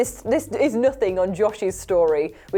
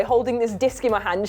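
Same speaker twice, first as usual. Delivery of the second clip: ordinary delivery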